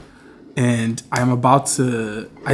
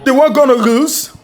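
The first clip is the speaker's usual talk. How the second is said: shouting at the TV; 12 semitones higher, 6 dB louder, slower than usual. Real, not acted. Shouting at football on TV.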